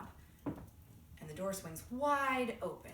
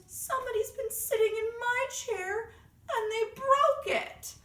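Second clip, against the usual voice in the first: high, loud, wailing little bear; Baby Bear; 8 dB louder than usual. Acted. Baby Bear finding his chair broken, high-pitched wail. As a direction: wail it high like an upset cub. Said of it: "And then Baby Bear looks and he goes".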